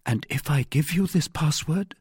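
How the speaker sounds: high, questioning woman; earnest heroine. A narrator's reading. Wife considers trusting the young man with password, earnest. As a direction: ask tentatively in a lifted female voice.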